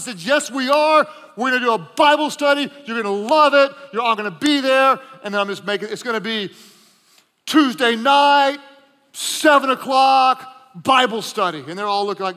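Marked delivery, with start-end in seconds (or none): 0.2-5.0 loud and firm, higher; insistent. Retold or imitated. Insisting to frat brothers. 7.4-11.6 emphatic announcement. Retold or imitated. Announcing the meeting.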